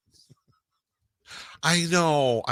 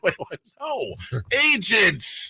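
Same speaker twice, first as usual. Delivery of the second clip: much higher, startled then indignant; mock realization; 10 semitones higher, slower than usual. Host imitating the homeowner realizing the mix-up, high and startled. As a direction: play the homeowner catching on with a pitched-up yelp.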